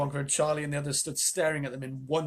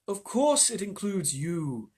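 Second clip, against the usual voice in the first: reassuring; 5 semitones higher, much slower than usual. Acted. Wonka reassuring grandparents.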